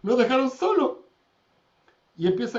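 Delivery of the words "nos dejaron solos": loud, high-pitched imitated yelling; retold shout. Manager shouting to directors that everyone left, retold shout. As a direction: cry out as the alarmed boss in a story. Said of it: "Y le grita todo".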